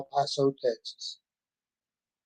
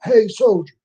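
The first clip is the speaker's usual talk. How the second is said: higher, louder call across the airport; a retold holler at a soldier; mock yell from a stranger across a crowd; 8 semitones higher, 7 dB louder than usual. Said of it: "Some guy is hollering".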